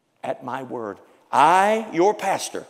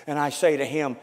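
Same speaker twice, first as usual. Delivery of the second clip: ordinary delivery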